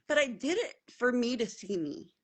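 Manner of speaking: usual speaking voice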